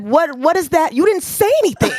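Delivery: high-pitched incredulous comeback; exasperated disbelief, retold for laughs; retold bafflement. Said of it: "And I said".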